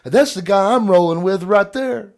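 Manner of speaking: high, loud cocky brag; cocky boast. Retold or imitated. Friend pointing at dad to the rival players, high loud brag. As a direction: point and brag to the other team. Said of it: "he'd point at Dad and say".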